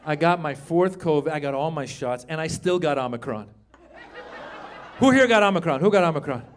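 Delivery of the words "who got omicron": normal talking voice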